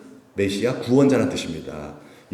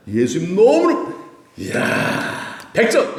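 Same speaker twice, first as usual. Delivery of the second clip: delighted praise; 9 semitones higher, 4 dB louder, much slower than usual. Acted. Acting Jesus praising Peter.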